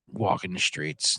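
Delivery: normal talking voice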